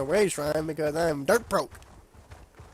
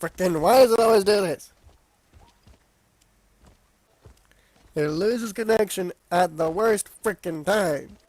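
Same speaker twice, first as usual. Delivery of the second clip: frustrated; 3 semitones higher, 5 dB louder than usual. Real, not acted. Controller keeps disconnecting.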